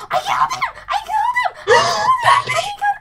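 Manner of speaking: very high, loud victory shriek; real gamer triumph, gasping and shrieking; ecstatic screaming. Real, not acted. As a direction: scream it over and over in disbelief. Celebrating a surprise kill.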